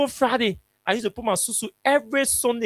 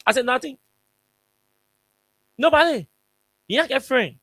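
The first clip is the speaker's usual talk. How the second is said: higher, louder insistence; insisting nobody came, higher and louder; emphatic denial; 6 semitones higher, 4 dB louder than usual. Real, not acted. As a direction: push back hard on the claim.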